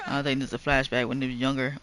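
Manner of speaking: ordinary talk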